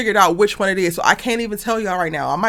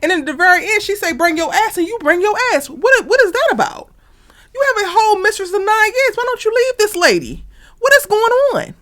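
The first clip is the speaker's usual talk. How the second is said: exasperated rant; 8 semitones higher, 4 dB louder than usual. Real, not acted. Ranting at the dad character.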